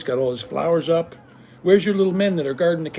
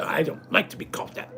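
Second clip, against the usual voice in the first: mocking whisper; 5 dB quieter than usual. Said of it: "And she go".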